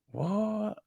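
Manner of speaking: high, soft baffled "What?"; a quiet gasp of disbelief. Retold or imitated. Imagined baffled reaction.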